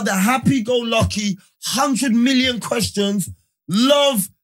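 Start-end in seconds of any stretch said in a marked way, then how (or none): none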